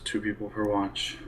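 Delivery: ordinary delivery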